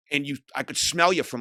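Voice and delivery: ordinary talk, nothing marked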